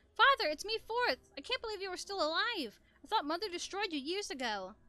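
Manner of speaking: high, loud and eager; excited. Acted. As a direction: burst out, thrilled he's alive. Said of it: "despite my excitement".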